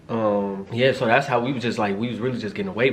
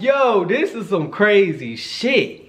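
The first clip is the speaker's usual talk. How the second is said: amazed; 8 semitones higher, 3 dB louder, much slower than usual. Retold or imitated. Watching her touch herself.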